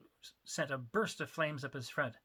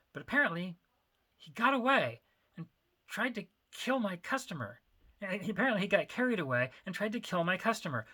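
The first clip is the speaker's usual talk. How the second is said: higher, agitated rushing confession; frantic, guilty outpouring; 5 semitones higher, 4 dB louder than usual. Acted. Nervous confession.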